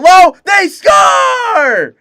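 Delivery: excited goal shout, voice cracking high. Real, not acted. Announcer calling a goal live, voice cracking on the shout. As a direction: shout it loud and let the voice break upward. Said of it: "that was a horrible voice crack".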